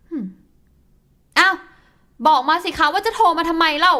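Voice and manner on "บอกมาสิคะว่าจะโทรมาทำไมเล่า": louder, insistent demand; wife loudly demanding why he called; angry demand. Acted. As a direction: push him for an answer, angrily.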